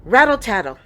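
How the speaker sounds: rattle sound. A narrator's reading. Storm rattling windows.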